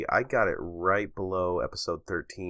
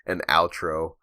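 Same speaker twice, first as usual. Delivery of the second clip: normal talking voice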